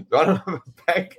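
quick line broken by giggles; laughing. Real, not acted. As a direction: laugh your way through it. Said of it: "it's making me laugh".

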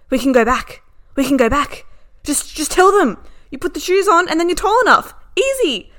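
loud, high, animated driver imitation; excited urging. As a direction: urge them back as if it's simple. Driver urging her to go back.

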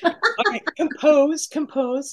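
high-pitched, giggly self-coaching; composing oneself after laughing. Real, not acted. Host telling themselves to settle down for the outro, amused. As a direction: pull yourself together through leftover giggles. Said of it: "Sorry, I'm laughing".

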